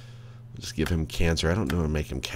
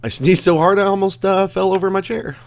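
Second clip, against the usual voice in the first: higher, louder breathless telling; shaken and excited; 14 semitones higher, 9 dB louder than usual. Real, not acted. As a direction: blurt it out still rattled. Recovering from the sneeze.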